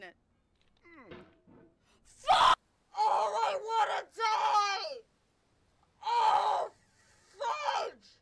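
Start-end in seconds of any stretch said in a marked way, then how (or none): 2.1-8.2 very high, loud shriek; anguished scream. Real, not acted. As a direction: scream out in defeat. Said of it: "just by screaming at this game".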